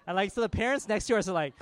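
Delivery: ordinary talk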